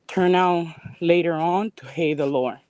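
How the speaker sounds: usual speaking voice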